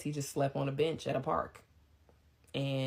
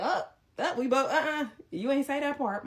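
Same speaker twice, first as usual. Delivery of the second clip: high, loud cutting-in protest; startled objection; 11 semitones higher, 5 dB louder than usual. Retold or imitated. Listeners catching a new detail in his story, startled objection.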